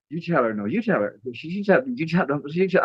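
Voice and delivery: imitating two people passing the buck; nervous bickering. Acted bickering over who tells mom.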